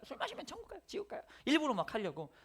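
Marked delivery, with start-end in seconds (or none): 0.0-1.2 lightly voiced teen, higher; mock a kid's cheeky question; middle schooler. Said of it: "중학생들이 막 물어본다니까요".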